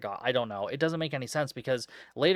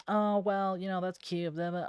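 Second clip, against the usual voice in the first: condescending mock voice; 6 semitones higher than usual. Imitating Gina's condescension.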